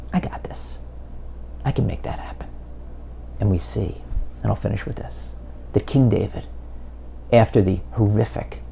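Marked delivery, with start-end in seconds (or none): 0.1-2.5 ruhiges, geflüstertes Versprechen; whispered assurance. Acted. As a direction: leise und gelassen, ganz sicher. God answers calmly.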